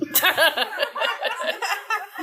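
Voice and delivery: quick high giggle; high laugh. Real, not acted. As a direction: giggle at the joke. Laughs during joke.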